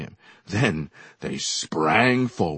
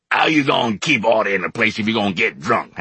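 angry shout up the stairs; exasperated shout; 3 semitones higher, 6 dB louder than usual. A narrator's reading. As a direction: yell at the drunk attendant. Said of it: "Hallie shouted".

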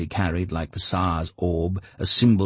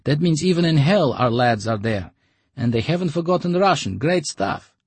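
higher, louder, fast joking; cheery Lyokha; 10 semitones higher, 4 dB louder than usual. Acted. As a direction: crack it as a cheerful joke.

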